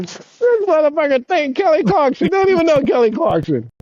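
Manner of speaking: high-pitched mock indignation through amusement; comically mad. Skater recounting being mistaken in thanks, comically mad. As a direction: retell your outrage, pitched high but not shouted. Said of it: "I'm so mad".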